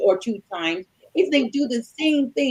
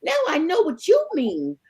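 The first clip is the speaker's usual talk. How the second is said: much higher, sugary innocent tone; mock sweet innocence; 7 semitones higher than usual. Wife turning husband's excuse back on him, mock sweet. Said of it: "I said".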